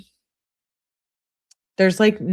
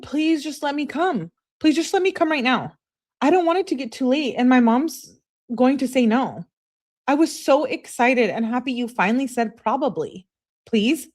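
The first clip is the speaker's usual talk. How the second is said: higher-pitched, needy begging; imitated pleading; 7 semitones higher than usual. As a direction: act out a girlfriend begging to come over. Acting out texter's desperate pleas.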